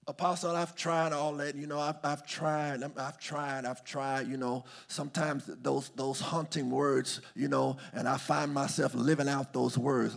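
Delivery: soft, weary mock excuse; discouraged congregant. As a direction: plead quietly, stumbling over repeats. Voices a discouraged congregant's objection.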